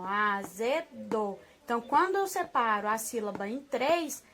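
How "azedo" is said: "Azedo" very slow, split into three syllables; separação de sílabas. Shown as a demonstration. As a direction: say "Azedo" as a-ze-do, drawing out each part. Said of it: "olha só a separação". ("Azedo" bem lento, separando as sílabas)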